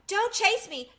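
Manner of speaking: put-on a tiny china girl squealing in fright; frightened little voice. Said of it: "such a frightened little voice".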